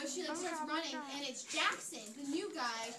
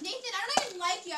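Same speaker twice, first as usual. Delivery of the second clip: high, loud, fast retort; acted angry rejection; 7 semitones higher, 8 dB louder, faster than usual. Girl confronted by Nathan rejects him, acted indignation. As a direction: push him away in a raised, rushed voice.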